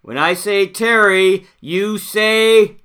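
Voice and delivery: put-on rally the kids to answer; camp counselor cheer. Leading a school cheer.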